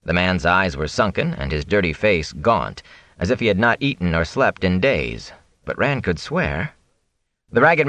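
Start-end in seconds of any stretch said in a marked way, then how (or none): none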